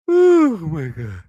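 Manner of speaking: big yawn through the words. Real, not acted. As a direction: let a yawn pull the words open. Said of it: "I'm yawning like crazy".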